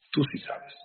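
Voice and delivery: hushed unvoiced aside; confiding whisper. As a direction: lean in and whisper it to each listener. Pointed aside to each listener.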